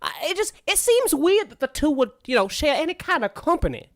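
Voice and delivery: high, fretful character voice; puzzled game character. Acted.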